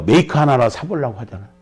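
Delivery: normal talking voice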